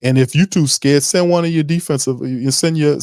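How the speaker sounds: usual speaking voice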